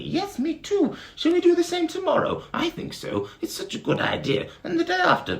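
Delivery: high, bright bee chirping; sing-song like a tiny bee; very high buzzing bee. Acted. Bee agreeing with its friend, very high voice. Bees chatting merrily.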